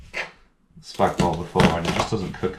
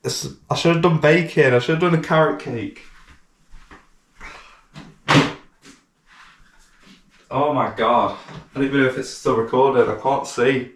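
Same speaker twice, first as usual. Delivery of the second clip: yelling in pain with onion in his eyes; real yelling; 5 semitones higher than usual. Real, not acted. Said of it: "I shouldn't yell".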